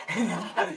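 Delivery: low, soft evil laugh; villain chuckle. Acted. Sadako plotting to catch all three, quiet evil laugh. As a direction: chuckle darkly over the plan.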